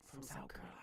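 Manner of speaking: dropped to a whisper for the punchline; disbelieving aside in a whisper. Real, not acted. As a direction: let it slip out, breathless and unvoiced. Punchline about the uncle's origin.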